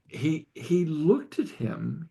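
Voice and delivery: ordinary talk, nothing marked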